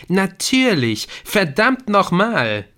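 loud, high, drawn-out exclamation; amazed realisation. A narrator's reading. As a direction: let the realisation burst out slowly. Viktor suddenly grasps the solution. (begeisterte Erkenntnis, laut und gedehnt)

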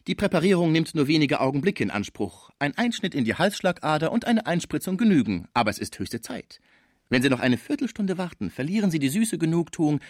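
high-pitched salesman talking up embalming; eager salesman. A narrator's reading. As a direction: sell it like a keen young agent.

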